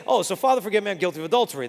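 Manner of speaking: rushed confession. Retold or imitated. Dario confessing to the deaf priest.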